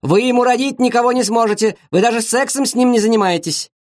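high-pitched scornful tirade; acted quarrel, voice pitched right up; heated berating. A narrator's reading. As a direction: berate her in a high, indignant voice. Mother berates girlfriend in quarrel.

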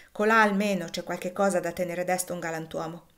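ordinary delivery

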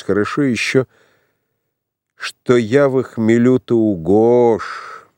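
put-on a tipsy young prince rambling; drunk tsarevich. A narrator's reading.

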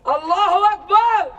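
high, loud cry of Allahu Akbar; a rally cry over the crowd; ecstatic cry. Acted. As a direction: throw it out high and full. Chanting cry in protest poem.

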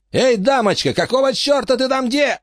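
high, sneering thug voice; mock the crazy old lady. Bandit yells at lady.

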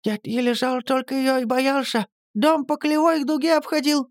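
high lisping rush of words; lisping patter. A narrator's reading. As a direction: lisp it out quickly like an old crone. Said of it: "шепеляво затараторила Настя".

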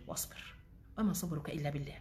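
soft, murmured verse; quiet recitation. Acted. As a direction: say the verse softly to yourself. Repeating the verse softly.

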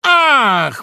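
high, loud gasp of joy; joyful exclamation. A narrator's reading. Fox discovering the rooster, joyful cry. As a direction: burst out in delight. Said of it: "воскликнул радостно лиса".

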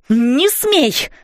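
shrill yell of protest. A narrator's reading. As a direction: scream at her to stop. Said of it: "вопила Донателла".

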